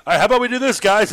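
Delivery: eager writers' room voice. Retold or imitated. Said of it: "they're all".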